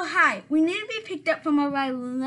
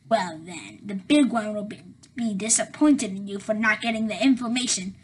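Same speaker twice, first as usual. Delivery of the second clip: villain voice; 5 semitones lower, 5 dB louder than usual. Acted. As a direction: lower menacing henchman voice. Two villain henchmen argue.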